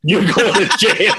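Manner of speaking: laughing mock verdict. Storyteller quoting the cop sending him to jail, laughing. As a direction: repeat the bad news, cracking up.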